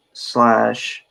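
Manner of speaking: ordinary delivery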